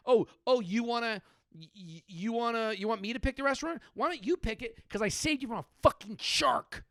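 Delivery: high-pitched, mock-outraged sputtering; incredulous rant, pitched well up; indignant husband. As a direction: throw it back in the wife's face. Acted restaurant argument with wife.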